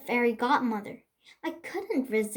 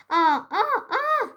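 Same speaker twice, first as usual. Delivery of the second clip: high, loud rising scream; 10 semitones higher, 5 dB louder, slower than usual. Acted. Child running circles around trick-or-treaters, high scream. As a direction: scream at them while circling. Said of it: "I screamed".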